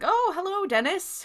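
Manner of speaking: high-pitched hello to an acquaintance; acted friendly greeting. Acting out customer greeting store clerk.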